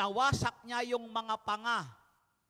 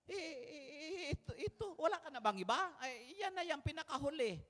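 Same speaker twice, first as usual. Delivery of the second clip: higher, fast, softer fumbling father; a hint of a nervous dad caught off guard; flustered father; 7 semitones higher, 6 dB quieter than usual. Jesse fumbling before Samuel.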